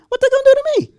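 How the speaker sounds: comically frightened, very high and loud; scared squeak. Acted. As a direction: squeal it in mock panic. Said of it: "your voice goes up an octave".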